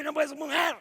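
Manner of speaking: loud, high shouted husband voice; husband shouting. Acted. Husband forbids his wife, shouting.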